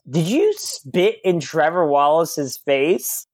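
slow, drawn-out retold accusation; incredulous owner. Club owner confronts his host at the door, slow incredulous question. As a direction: spell out the question in mock disbelief.

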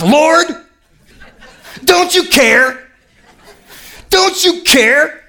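high, drawn-out indignant plea; indignant Martha. Acted. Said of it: "and she's ticked".

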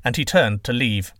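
usual speaking voice

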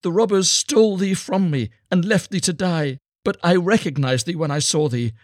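high, loud, desperate appeal; impassioned mother begging her son; pleading cry. A narrator's reading. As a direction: implore with full emotion. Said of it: "she cried".

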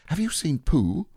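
put-on high boy's voice cutting in; anxious Christopher Robin. Boy interrupting Owl about Pooh, high and anxious. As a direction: break in with a high, worried question.

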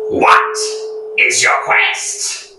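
put-on very high, rasping old keeper; bridge keeper voice. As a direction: shriek like an ancient gatekeeper. Bridge keeper questions knight.

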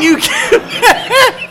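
high, slow and leering, laughing; creepy sexual voice. Acted. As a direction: drag it out like a creep coming on. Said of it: "it was real sexual, like, and creepy".

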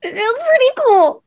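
high, loud giggling delight; laughing. Real, not acted. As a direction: laugh it out happily. Gushing about dream job.

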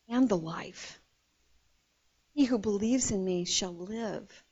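low, soft and unhurried; tenderly reassure, with room between phrases; gentle reassurance. Acted. Jesus comforts grieving Martha, gently reassuring.

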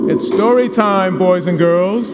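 loud, drawn-out story time voice; storytelling voice. Shown as a demonstration. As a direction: tell it like to a room of toddlers. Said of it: "tell stories".

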